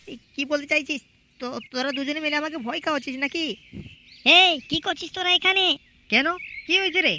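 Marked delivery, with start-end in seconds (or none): 0.1-3.4 much softer, wary question; hushed suspicion. Acted. Man suspects he's being scared.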